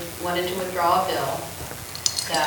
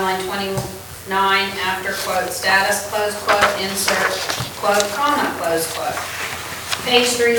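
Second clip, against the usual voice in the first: dictating; 2 semitones higher, 4 dB louder than usual. Real, not acted. Reading amendment text aloud.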